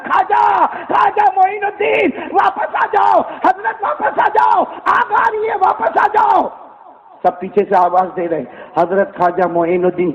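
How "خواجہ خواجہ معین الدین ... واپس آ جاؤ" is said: calling out. A narrator's reading. Villagers shouting after the saint to return, desperate calling. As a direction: shout after him to come back. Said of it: "اب سب نے پیچھے سے چلانا شروع کیا".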